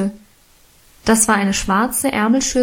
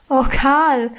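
higher, plaintive lament; wailing; 4 semitones higher than usual. A narrator's reading. Girl turning to Karl in guilt, wailing. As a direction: mildly wail the name. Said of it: "jammerte ich".

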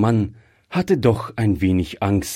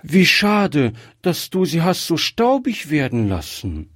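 mild exclamation; 7 semitones higher than usual. A narrator's reading. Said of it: "rief Gretchen".

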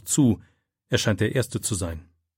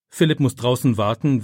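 plain reading voice

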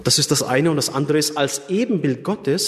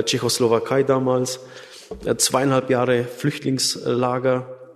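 ordinary delivery

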